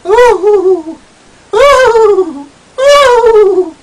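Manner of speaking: very high, loud ecstatic whoops; camp queen's whoops. Retold or imitated. Camp queen whooping in rapture at night, very high and loud. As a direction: squeal with delight in a high voice. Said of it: "A camp queen with a high voice".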